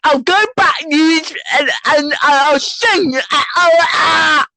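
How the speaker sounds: high, escalating roadman threats; hammed-up roadman, building to high yelps; mock roadman rant. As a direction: parody a roadman working himself into wordless noise. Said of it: "That's what they sound like".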